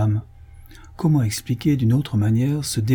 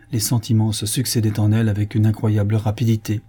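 plain reading voice

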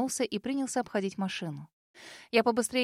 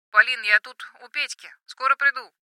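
loud; 3 semitones higher, 7 dB louder than usual. Acted. Brother calling on phone.

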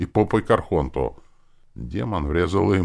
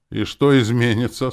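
ordinary delivery, nothing marked

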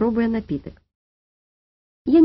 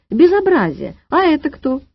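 loud, high outrage; indignant hissing; 6 semitones higher, 4 dB louder than usual. A narrator's reading. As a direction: voice raised in indignation. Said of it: "зашипела санэпидемстанция". (возмущённо, громко)

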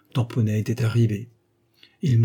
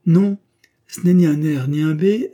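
loud, high, abrupt objection; sudden realisation; 6 semitones higher, 7 dB louder than usual. A narrator's reading. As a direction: burst out with the correction. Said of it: "Puis tout à coup". (exclamation soudaine)

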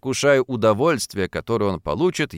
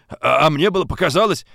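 winded, higher-pitched stammer; out of breath; 5 semitones higher, 4 dB louder than usual. A narrator's reading. Watchman winded from the run, breathless excuse. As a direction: stumble on the first sound, panting. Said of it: "задыхаясь от быстрого бега".

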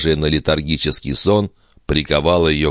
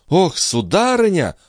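high, startled exclamation; sudden cry; 13 semitones higher than usual. A narrator's reading. Maid suddenly alarming her mistress, startled high cry. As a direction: exclaim with pitch leaping up. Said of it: "вдруг вскричала служанка".

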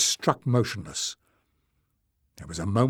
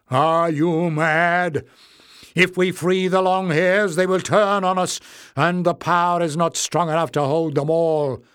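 loud, higher-pitched angry outburst; 6 semitones higher, 5 dB louder than usual. A narrator's reading. Leader warning the crowd against freeing long hairs, loudly angry. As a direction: argue hotly, voice raised. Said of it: "face was twisted with anger".

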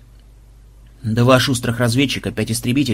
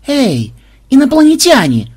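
high loud hail; calling out; 12 semitones higher, 6 dB louder than usual. Acted. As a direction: shout it up across the field. Character calls out to aliens. (громкий оклик)

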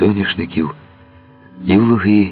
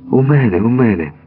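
higher-pitched relieved answer; 7 semitones higher than usual. A narrator's reading. Woman answering the man outside the window, relieved. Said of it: "полегшено зітхнула Мирослава".

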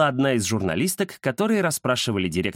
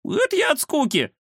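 high-pitched cat owning up; confession. A narrator's reading. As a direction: own up in a squeaky-high cat voice. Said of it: "признался Кузя". (признание высоким голосом кота)

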